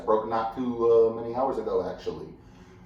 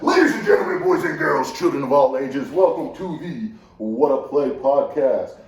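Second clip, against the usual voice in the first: booming show announcer, much higher and louder; 4 semitones higher, 9 dB louder than usual. Acted. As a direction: roll it out like a ringmaster.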